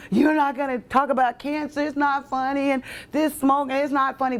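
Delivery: mock warning from worried people; disapproving friends. People were like.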